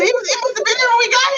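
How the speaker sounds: put-on high, fast, nervous kid's lie; little kid lying to her dad, high and hurried; panicked child excuse. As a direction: squeak out a rushed excuse. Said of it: "I said".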